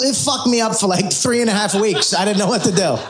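ordinary talk, nothing marked

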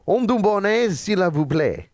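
French subtitle line read with French pronunciation; French accent. Shown as a demonstration. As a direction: put on your best French accent. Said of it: "bring out the French in me".

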